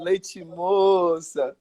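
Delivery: very slow teasing taunt; drawn-out heckle. Retold or imitated. Drunk fan needles the goalkeeper, slow teasing drawl. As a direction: imitate a heckler, stretching the words right out. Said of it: "ele olhava pra mim e dizia assim".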